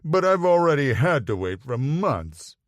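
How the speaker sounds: a hint of a pouting toddler. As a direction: sulk like a child denied a treat. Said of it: "pouted like a toddler".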